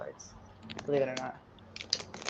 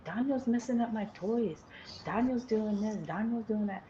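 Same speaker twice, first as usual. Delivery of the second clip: higher, lightly voiced child tattling; little son complaining; 7 semitones higher than usual. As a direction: whine like a small boy telling on his brother. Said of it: "my son would tell me".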